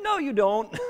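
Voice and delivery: gently puncture their confidence; teasing denial. Retold or imitated. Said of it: "And he's like".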